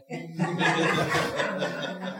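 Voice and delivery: quiet chuckle to himself; a short amused laugh; soft laugh. Real, not acted. Laughs at the note.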